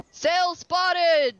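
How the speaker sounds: high carrying shout; loud shout. Acted. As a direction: bellow it across the ship. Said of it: "the rather gruff voice of".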